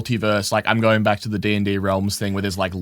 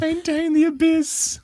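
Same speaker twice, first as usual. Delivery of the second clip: much higher, slow, amused repeat; mock grand echo. Real, not acted. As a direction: savor the phrase like a ridiculous title. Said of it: "echoing phrase".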